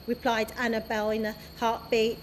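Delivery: ordinary delivery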